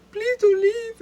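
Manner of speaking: high, loud mock plea, a cry in the voice; mock pleading. Left in his skivvies, he begs them to stay in a high, loud mock whine. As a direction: beg them not to go, hammed up.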